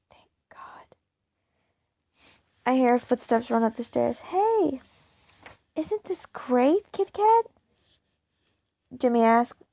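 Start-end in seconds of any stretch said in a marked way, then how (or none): 0.1-1.0 breathy relieved whisper, barely voiced; whispered relief, almost silent. Quiet relief alone in her room. 4.2-7.5 high, bright call with a slow middle; upbeat shout of welcome rising high; excited greeting. Acted. Jimmy bursts in excited.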